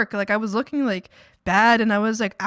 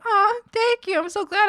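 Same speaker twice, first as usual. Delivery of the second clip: high gush; 11 semitones higher than usual. Retold or imitated. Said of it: "And I'm like".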